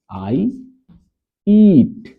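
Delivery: I eat, with eat high and loud; stress on eat. Shown as a demonstration. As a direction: punch the word eat up high. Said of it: "verb का प्रयोग again". (eat पर ज़ोर, ऊँची आवाज़ में)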